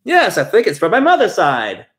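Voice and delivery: vaudeville bad acting. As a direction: land the pun like old vaudeville. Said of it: "Let's deliver it like we're in, in vaudeville".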